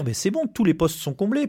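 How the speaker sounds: high, offhand parody of a satisfied boss; mock smug management. Union rep parodying management claiming posts are filled, high smug mockery. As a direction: lift the voice into a smug, careless official. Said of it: "et dire".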